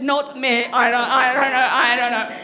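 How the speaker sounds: mock denial from the shaking head; flustered denial. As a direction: play a caught-out audience member denying it. Voicing a head-shaking audience member.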